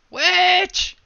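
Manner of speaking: very high, loud shriek; shouting witch. Real, not acted. Spotting the witch in the play, a shrieked cry. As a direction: shriek the word in shock.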